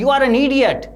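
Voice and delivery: hammed-up angry shout at the boy; a teacher shouting at Sebi in class, mock fury; acted furious shout. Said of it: "he shouted".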